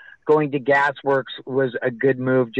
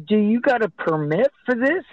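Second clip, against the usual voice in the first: higher-pitched, demanding question; challenging official; 9 semitones higher than usual. Retold or imitated. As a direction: imitate an official demanding paperwork. Retold parks official challenges him.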